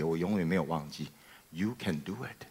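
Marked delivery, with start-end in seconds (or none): 1.5-2.5 soft quoted encouragement. Retold or imitated. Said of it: "他跟我说一句话好特别".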